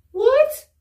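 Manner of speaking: a sudden, high, loud "What?"; shocked. Retold or imitated. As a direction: jump way up in alarm. Teacher shocked.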